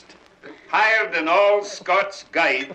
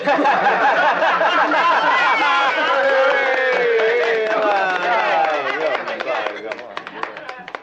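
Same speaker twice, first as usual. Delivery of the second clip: long loud laugh; 5 semitones higher, 5 dB louder than usual. Real, not acted. Laughs after joke.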